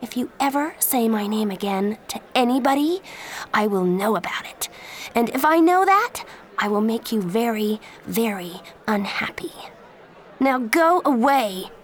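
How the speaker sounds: angry threat. Acted. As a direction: warn him off with cold fury. Threatening Danny.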